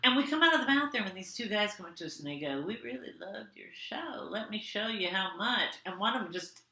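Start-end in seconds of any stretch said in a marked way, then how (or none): 2.6-5.7 imitated creepy murmur; quiet creepy admirer. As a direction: say it softly, like an unwelcome flirt. Creepy men approaching in the story.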